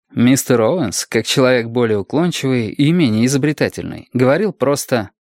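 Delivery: usual speaking voice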